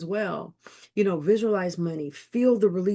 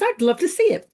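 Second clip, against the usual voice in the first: eager customer; 11 semitones higher, 5 dB louder than usual. Retold or imitated. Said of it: "I says".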